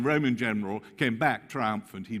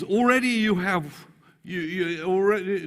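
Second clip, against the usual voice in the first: sarcastic Paul; 10 semitones higher, 9 dB louder than usual. Acted. Preacher voicing Paul's sarcastic opening.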